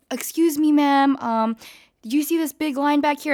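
playing the polite but firm employee; airport worker. Airport worker telling the narrator to go back, mock stern.

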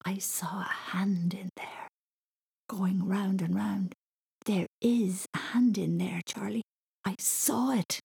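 put-on hushed, frightened child's whisper; breathy half-whisper, drawn out. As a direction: whisper a scary secret insistently. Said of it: "and he whispered".

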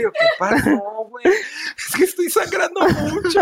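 high, laughing mock panic; mock frightened high voice. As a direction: squeak it out in hammed-up fright. Imita llamada asustada de amiga.

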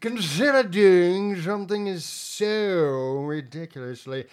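put-on a stuffy old British officer, appalled; General Allenby impression. Host doing Allenby to mock his co-host's overrun, British officer voice. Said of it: "I think that's a pretty spot on Allenby".

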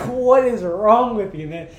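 loud, high, slow disbelief; re-enacted baffled exclamation; incredulous outcry. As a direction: stretch it out, appalled at your friend. Retelling disbelief at friend's showers.

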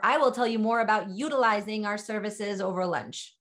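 ordinary delivery

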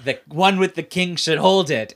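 slow, put-on grand voice; hammed-up royal pronouncement; mock pompous line. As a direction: drag it out like a solemn oracle. Mocking character in recap.

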